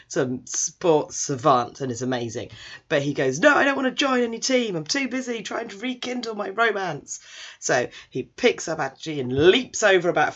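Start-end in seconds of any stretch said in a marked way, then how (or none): 3.4-7.2 raised, lightly hammed-up hero voice; theatrical brush-off, pitched up; dramatic refusal. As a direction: refuse with mock lovelorn urgency.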